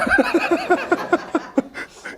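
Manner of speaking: a long high laugh trailing down. Real, not acted. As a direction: let a high laugh roll out and fade. Laughs at heaven joke.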